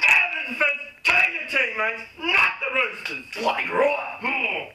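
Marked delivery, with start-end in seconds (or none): none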